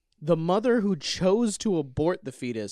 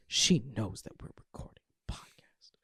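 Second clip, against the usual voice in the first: soft unvoiced whisper; 5 semitones lower, 6 dB quieter than usual. Real, not acted. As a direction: whisper it aside with a laugh. Whispered aside.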